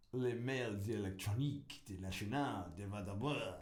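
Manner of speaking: hammed-up mock French accent, rolling out a fake official name; a lightly put-on French accent, half laughing. Poking fun at the French word for email, mock French accent. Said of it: "it's like the French name for email".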